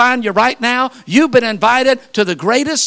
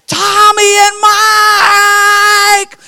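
put-on long, high sing-out yell to the children; mom's full-voiced supper call; mother hollering for her kids; 9 semitones higher, 6 dB louder, much slower than usual. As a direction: holler it loud and drawn-out, like calling kids home. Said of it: "yelling at your kids".

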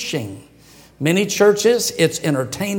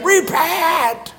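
much higher, fiery mock shout of a wild preacher; wild prophet cry; 10 semitones higher than usual. Preacher mimicking John the Baptist crying out, hammed-up shout. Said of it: "foaming at the mouth saying".

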